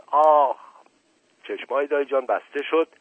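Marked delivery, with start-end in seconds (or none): 0.1-0.6 pained cry. Acted. Uncle collapses.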